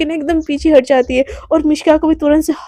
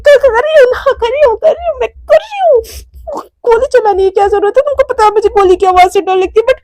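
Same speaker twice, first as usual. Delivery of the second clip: very high panicked giving-in; high frightened pleading, ending in a laugh; terrified squeal; 7 semitones higher than usual. Acted. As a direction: squeak in fear of the gun. Mishka terrified by gunshots.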